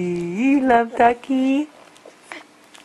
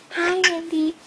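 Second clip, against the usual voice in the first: toy duck voice; 8 semitones higher than usual. Pretend. Voicing the duck.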